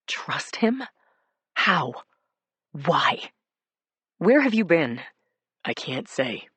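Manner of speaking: ordinary delivery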